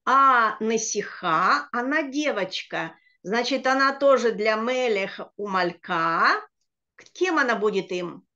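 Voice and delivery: ordinary talk, nothing marked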